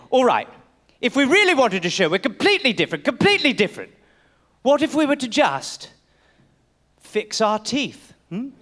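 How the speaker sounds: hesitant mock British accent; British gentleman. Colonist floating a drastic idea to his friend, British accent. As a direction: build up the proposal, then pause before the end.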